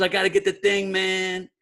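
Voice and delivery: a hint of a spacey Mulberry Street guy; New York accent. Director asking for the dustpan, imitated in mild New York street accent. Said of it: "he goes".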